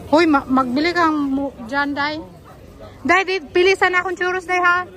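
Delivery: high, loud call to a companion. Real, not acted. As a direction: call across the shop with a request. Calling companion to buy churros. (pasigaw na pakiusap sa kasama)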